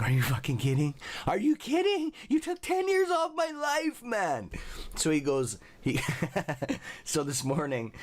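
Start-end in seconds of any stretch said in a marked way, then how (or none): 0.0-4.5 shrieking very high in disbelief; incredulous outrage. Retold or imitated. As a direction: squeal it like you can't believe it. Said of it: "I'm like".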